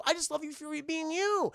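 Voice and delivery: high, sugary mock-child declaration; imitating a kid. Son declares love for dad, mock-earnest child. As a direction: lift it into a little boy's gush. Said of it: "And the kid goes".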